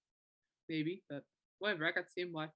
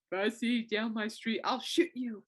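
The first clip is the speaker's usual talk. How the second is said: a hint of a mock tough dad, higher and louder; protective dad threat; 5 semitones higher, 6 dB louder than usual. Dad warning his daughter's future boyfriends, mock-threatening. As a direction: play the overprotective father warning suitors. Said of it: "I can actually see my dad saying that".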